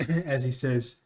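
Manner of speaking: normal talking voice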